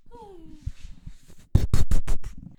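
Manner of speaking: big groaning sigh of defeat; loud exasperated sigh. Real, not acted. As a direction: heave a loud frustrated sigh. Loud sigh before starting over.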